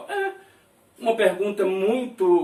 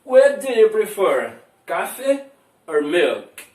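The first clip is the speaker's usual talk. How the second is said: model question; 6 dB louder, slower than usual. Shown as a demonstration. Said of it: "ó".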